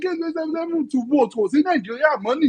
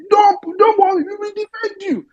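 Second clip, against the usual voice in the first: loud, choked-up reassurance, words breaking; tearful shout; 4 semitones higher, 6 dB louder than usual. Real, not acted. To the new Abia governor, a loud tearful promise. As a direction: cry out a promise to protect her.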